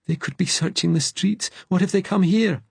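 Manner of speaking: high, rising alarm; panicked. A narrator's reading. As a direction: panic that they're coming, pitched very high. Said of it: "panic searing through him".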